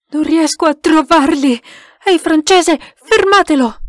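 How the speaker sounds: loud, high, desperate call; frantic shout. A narrator's reading. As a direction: yell for someone to stop it. Shouting for help during attack.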